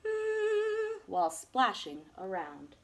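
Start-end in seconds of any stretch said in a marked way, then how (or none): 0.1-1.0 a high, humming-fish hum. Acted. The humming fish in the pond, a high playful hum. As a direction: hum it like a happy little fish.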